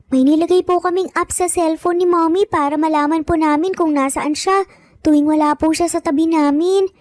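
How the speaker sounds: put-on high little boy voice, earnest; child explaining. A narrator's reading. Little boy explains the phone tracking app, high and earnest. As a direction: explain it like an eager small kid. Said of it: "Paliwanag nga ni Luke".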